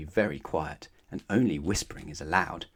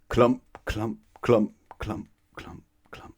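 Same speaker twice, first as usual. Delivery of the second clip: fading footsteps; 3 semitones higher, slower than usual. A narrator's reading. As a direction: let the steps die away. Footsteps dying away.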